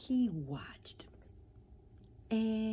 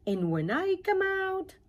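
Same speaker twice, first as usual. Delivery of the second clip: high and louder, hopeful; caterpillar voice; 13 semitones higher, 8 dB louder than usual. Acted. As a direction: sing out the promise brightly. Caterpillar voiced in read story.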